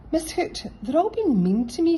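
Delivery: high, loud whiny complaint; complaining. A narrator's reading. Rabbit whining to Miss Hoot about the others. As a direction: whine to the teacher. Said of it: "complained Rabbit".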